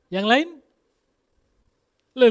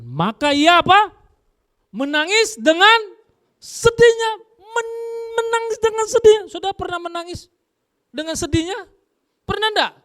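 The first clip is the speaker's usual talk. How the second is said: preaching emphasis; 8 dB louder than usual. Acted. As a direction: drive home the weeping. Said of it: "preacher stresses weeping".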